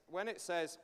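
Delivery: ordinary talk, nothing marked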